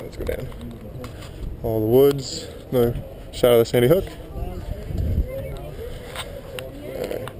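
usual speaking voice

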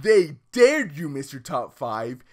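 ordinary delivery

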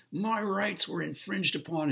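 high, loud whiny complaint about his rights; mock-indignant customer complaining his rights were infringed; indignant stranger. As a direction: mimic a self-righteous man demanding his rights. Mocking the angry stranger's complaint.

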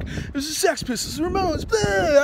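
high-pitched mock squabble; mocking bickering. Punk mimicking the who-started-punk debate, high mocking whine.

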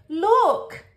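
put-on high, loud child's shout; excited yell. A narrator's reading. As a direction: shout for your sister to look. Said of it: "yells Bingo".

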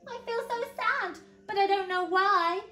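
put-on high-pitched, sorrowful little boy; mock-sad, high voice; big sigh. A narrator's reading. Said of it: "said Eddie with a great big sigh".

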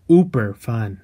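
ordinary talk

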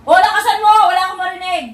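loud, high, exasperated yelling at her daughter; angry mother raising her voice; scolding shout. Real, not acted. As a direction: shout her down, she can't hear you. Mother scolding daughter, can't hear.

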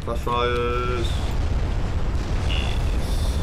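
loud gleeful shout of triumph; excited cheer. Real, not acted. Gamer cheering a flash fire on the enemy ship, loud and gleeful. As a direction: whoop it out as the hit lands.

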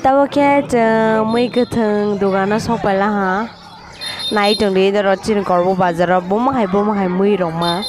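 ordinary talk, nothing marked

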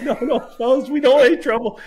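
high-pitched, hammed-up backing-down plea; comic high voice pleading with big guards; mock nervous plea. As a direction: squeak out a mock-scared apology to the bodyguards. Backing off from bodyguards, laughing.

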